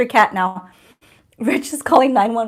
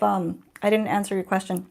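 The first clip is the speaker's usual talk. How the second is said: ordinary talk, nothing marked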